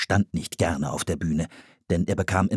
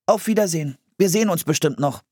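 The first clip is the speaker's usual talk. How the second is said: put-on high boy's voice, brisk goodbye; said aloud; 10 semitones higher, 3 dB louder, faster than usual. A narrator's reading. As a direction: hurry out a polite farewell. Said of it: "Laut sagte er".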